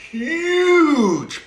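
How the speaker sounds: huge drawn out high and loud; stress on huge. A narrator's reading. Storyteller showing how big the pile is, "huge" drawn out. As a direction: stretch "huge" long and high.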